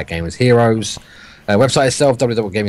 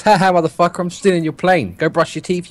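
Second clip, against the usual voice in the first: mock British accent, higher and taunting; bad British accent; 8 semitones higher than usual. Player taunting the Brit he robbed, bad British accent. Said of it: "in his best British accent".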